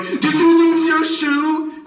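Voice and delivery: high, loud and slow yell; kid's scream. Retold or imitated. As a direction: scream it at a stranger like a wild kid. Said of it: "and I would scream".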